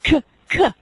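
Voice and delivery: "k" sounded out by itself; isolated k sound. Shown as a demonstration. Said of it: "the students say".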